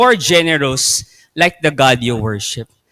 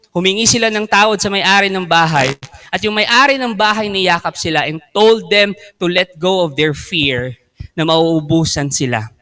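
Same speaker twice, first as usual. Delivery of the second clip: ordinary delivery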